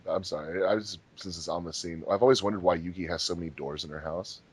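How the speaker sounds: ordinary talk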